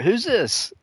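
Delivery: high puzzled who's this; mock Michael. Retelling The Office, Michael's high puzzled question. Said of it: "Michael was like".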